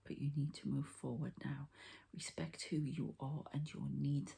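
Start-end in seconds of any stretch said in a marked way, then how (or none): none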